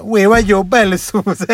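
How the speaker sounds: high, cartoonish Neapolitan dialect voice; playful penguin talking Neapolitan. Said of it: "un pinguino che parla napoletano".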